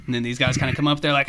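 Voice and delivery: usual speaking voice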